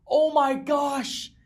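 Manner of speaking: high, slow, stretched oh my gosh; shocked gasp. Real, not acted. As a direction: drag it out in disbelief. Realizes the bench would have won.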